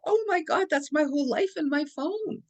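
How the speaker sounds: high, dismayed wail; distraught over the lost phone; retold panic.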